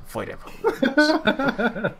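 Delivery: very high, slow, laughing cry; laughing high call. Real, not acted. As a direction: squeal it out through a laugh. Repeats the find, laughing.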